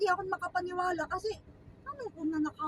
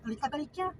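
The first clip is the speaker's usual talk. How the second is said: ordinary talk, nothing marked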